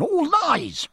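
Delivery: beside himself, hurl the denial; indignant cry. Acted. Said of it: "beside himself with indignation".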